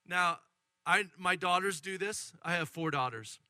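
ordinary delivery

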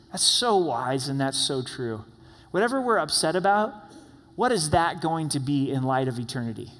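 ordinary delivery

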